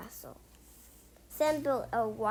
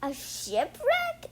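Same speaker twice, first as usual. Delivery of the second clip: amazed question; 9 semitones higher, slower than usual. Acted. Sam amazed at the shipwreck.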